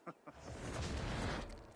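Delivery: a long contented laugh; hearty laugh. Acted. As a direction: laugh it off as the feast begins. Inviting Eivor to drink.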